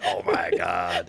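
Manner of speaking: low, loud, drawn-out exasperation; mock meltdown. Friend imagining the mother's meltdown, slow low mock despair. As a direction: groan it slowly and loudly, overdone. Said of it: "You had a meltdown".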